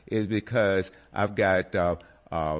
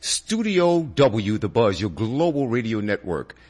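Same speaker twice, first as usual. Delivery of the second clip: radio announcer; 5 dB louder than usual. Acted. Radio show opening announcement.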